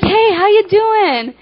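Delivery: high, loud, drawn-out hello; cheery greeting. Acted. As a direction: greet a best friend in a hammed-up singsong. Acted cheery greeting to best friend.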